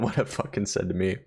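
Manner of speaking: ordinary talk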